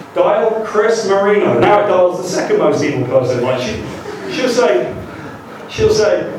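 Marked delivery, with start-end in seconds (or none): 0.1-1.6 slowly, each word spelled out for the machine; over-enunciate for the voice dialer; slow, careful command. Retold or imitated. Retrying the car's voice dialer, slow deliberate enunciation. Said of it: "So I press stop".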